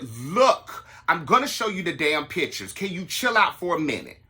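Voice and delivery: loud fed-up snap; snapping at him. Retold or imitated. Host snapping at his date at the party, loud. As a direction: lose it and tell him to chill. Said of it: "I lost my mind".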